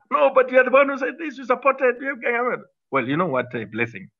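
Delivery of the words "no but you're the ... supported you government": high, fast mimicry of his critics, "No, but you're the one"; mocking accusers. Ex-minister mimics critics who blame him for the bond note, high and fast. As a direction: play the finger-pointing public, pitched well up and quick. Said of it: "people say to us".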